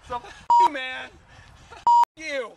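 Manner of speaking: loud, high swearing at someone; furious swearing. Acted. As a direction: curse out the crew. Swearing at crew.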